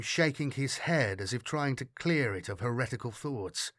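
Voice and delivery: ordinary delivery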